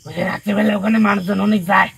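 high, very loud protest; shouted answer. Real, not acted. Spirit explains to the healer about night visitors, shouting.

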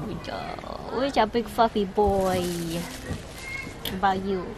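ordinary talk, nothing marked

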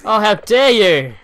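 loud, slow, high indignant cry breaking into a laugh; mock outrage at a great shot; outraged shout. Real, not acted. Gamer outraged at an enemy's skill, shouting and laughing.

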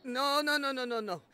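loud stammered terrified question; terrified gasp. Acted. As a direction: stammer it out in sudden terror. Terror at dead or alive.

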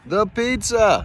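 "pizza" drawn out high and slow; emphatic. Real, not acted. Praising the pizza again.

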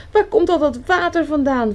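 fast, high and loud, panicking; a duck flooded out of her home; desperate. A narrator's reading. Duck asking Frog where the water comes from, desperate. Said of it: "vroeg Eend wanhopig".

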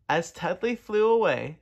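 plain reading voice

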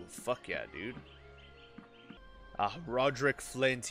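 ordinary delivery

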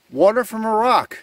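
amazed exclamation. Real, not acted. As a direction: exclaim at a surprising find. Amazed at spring from rock.

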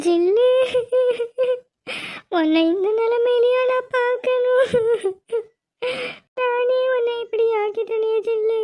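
high, tearful lament; crying. Acted. Grandmother weeps over her granddaughter at the ritual. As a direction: grieve through sobs over the child.

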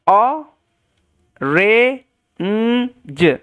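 "অ, রে, উম, জ" as separate held sounds with gaps; sounding out. Shown as a demonstration. Teacher modelling orange for learners, sounded out slowly. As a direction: slowly build orange: o, re, nnn, j.

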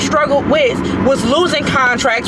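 loud, high-pitched, real angry rant. Real, not acted. Woman venting to online critics about losing and family, angry. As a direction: let it all out at full volume, high and heated.

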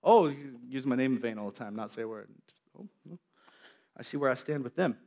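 low, soft hurt sarcasm; quietly wounded; hurt God. Retold or imitated. God hurt by his silent friends, low and soft. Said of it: "God's like".

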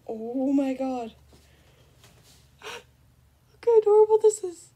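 high, loud, slow gushing. Real, not acted. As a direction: coo over something adorable. Gushing at cute item.

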